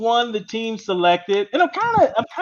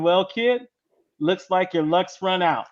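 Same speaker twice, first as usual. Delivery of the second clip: ordinary talk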